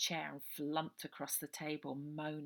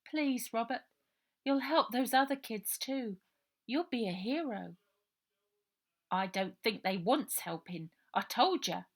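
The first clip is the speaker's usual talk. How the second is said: put-on higher, louder girl pleading then boy grumbling; children talking; 5 semitones higher, 7 dB louder than usual. Ida pleading and Robert refusing, children's voices. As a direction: flatter him, then shrug it off.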